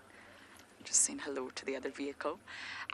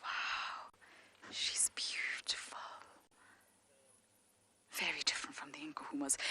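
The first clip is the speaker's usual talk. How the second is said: real whisper, much softer; hushed awe; 10 dB quieter than usual. Real, not acted. Safari guide admiring a lioness, awed whisper. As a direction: whisper in wonder so as not to disturb.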